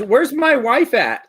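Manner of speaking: put-on high, slow eager child; kid voice. Eight-year-old Emil searching for his wife, high and slow. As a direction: call like a little boy. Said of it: "He says".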